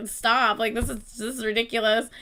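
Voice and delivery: normal talking voice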